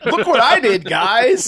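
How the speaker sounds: high, loud bragging; mock proud boasting. Metatron shows his friends what he did, boasting excitedly. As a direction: show off a feat as if it's amazing. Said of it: "acts like he did this amazing thing".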